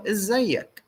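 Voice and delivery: izzayyak, rising greeting; Egyptian Arabic. Shown as a demonstration. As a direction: say izzayyak with a doubled z.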